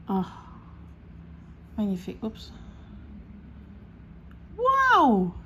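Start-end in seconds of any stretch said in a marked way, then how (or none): 4.6-5.3 thrilled wow. Real, not acted. Excited reaction while unboxing.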